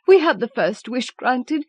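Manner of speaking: high, urgent feverish voice; burn with a feverish hope; old woman arguing feverishly with her husband. A narrator's reading. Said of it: "said the old woman feverishly".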